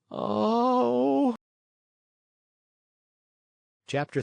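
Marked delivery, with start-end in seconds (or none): 0.1-1.4 a high sighing oh; longing oh. Acted. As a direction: sigh out with yearning. Pinocchio sighs with longing.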